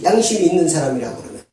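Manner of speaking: ordinary talk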